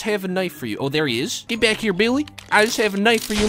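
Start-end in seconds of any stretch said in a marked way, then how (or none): none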